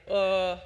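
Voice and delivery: a loud, stumped "uh"; a caught-out grunt with no answer. Retold or imitated. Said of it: "and I'm going like".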